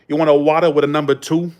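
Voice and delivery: imitated Boston drive-through worker; dropped-R Boston accent, rising question; Massachusetts accent. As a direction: take an order in a New England accent. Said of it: "you know, in Boston".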